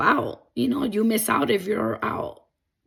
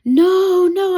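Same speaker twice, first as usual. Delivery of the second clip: much higher, much louder panicked no; retold scream; 9 semitones higher, 8 dB louder than usual. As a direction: cry out in remembered terror. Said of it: "started screaming. I started crying".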